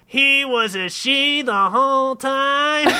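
high, slow, astonished squeal; drawn-out falsetto astonishment; surprised. A narrator's reading. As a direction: stretch it out in high-pitched disbelief.

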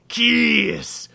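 high, drawn-out demon shouts; shouting. A narrator's reading. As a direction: shout the command, stretching the word. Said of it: "shouted the demon".

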